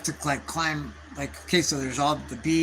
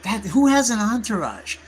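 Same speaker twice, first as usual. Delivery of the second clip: incredulous rant; 4 semitones higher than usual. Retold or imitated. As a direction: rant in mock outrage at the hangers-on. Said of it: "And I'm like".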